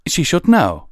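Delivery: high, loud, indignant retort; heated hiss. A narrator's reading. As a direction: insist angrily, pitched high. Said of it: "Pyre Valor hissed".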